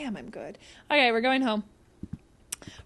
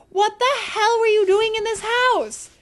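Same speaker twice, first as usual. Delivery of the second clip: high, raised-voice disbelief; appalled outburst; 9 semitones higher, 4 dB louder than usual. Real, not acted. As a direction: cry out at the state of the place. Exclaims at the messy game house.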